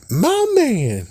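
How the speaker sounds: a squealed, delighted my man; high excited cheer. Real, not acted. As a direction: cheer him on like a proud buddy. Cheering again, higher.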